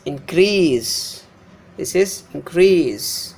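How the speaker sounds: increase with long, stressed -CREASE; inCREASE, second syllable drawn out; verb stress. Shown as a demonstration. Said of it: "difference between the pronunciation of the noun and the verb".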